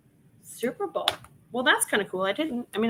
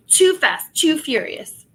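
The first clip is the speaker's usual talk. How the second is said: excited; 6 semitones higher, 7 dB louder, slower than usual. Real, not acted. Reading title excitedly.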